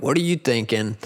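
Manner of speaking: normal talking voice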